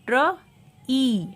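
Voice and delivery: ट्र and long ई high, loud and apart; sounding out. Shown as a demonstration. Teacher showing double E, sounding out ट्र, ई. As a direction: punch ट्र, pause, then ई. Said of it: "ई का long sound".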